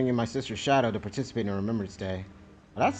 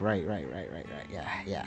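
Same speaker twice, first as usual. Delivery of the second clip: fading mumble; 9 semitones lower, 11 dB quieter than usual. Real, not acted. Caught out, trailing off.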